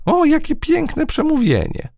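very high, sarcastic fly voice; mocking. A narrator's reading. Fly sneers at ant's speech, mocking. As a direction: jeer with sham admiration, pitched right up. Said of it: "mruknęła drwiąco lotniczka".

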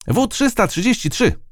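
mild shout. A narrator's reading. Captured ant shouts out its number, high and moderate. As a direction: report your number like a little soldier. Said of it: "krzyknęła".

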